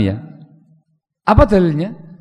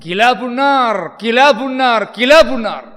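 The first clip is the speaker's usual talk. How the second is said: slow, high, drawn-out pronouncement; solemn proclaimed cry; 7 semitones higher, slower than usual. Retold or imitated. Abu Umamah declaring the dead Khawarij dogs of hell, slow solemn cry. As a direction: declare it slowly like a grave verdict.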